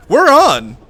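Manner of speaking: loud, high delighted yell; real thrill as the fight kicks off; excited shout. Real, not acted. Combat starts in game.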